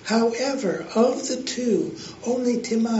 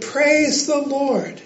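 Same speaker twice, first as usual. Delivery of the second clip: slow, high, drawn-out shout of praise; 6 semitones higher, 3 dB louder, slower than usual. A narrator's reading. Lady finding groceries on her porch, high slow shout. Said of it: "and shouted".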